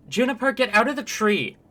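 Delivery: loud, high shouted order; calling out. Acted. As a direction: holler up at someone in a tree. Character calls up to Juniper.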